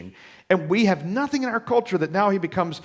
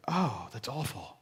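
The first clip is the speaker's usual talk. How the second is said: mock disapproval; 5 semitones lower, 11 dB quieter, slower than usual. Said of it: "we don't look at that and say".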